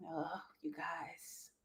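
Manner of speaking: hushed, slow whisper. Real, not acted. Host whispering to viewers before bad news. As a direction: breathe it like a secret.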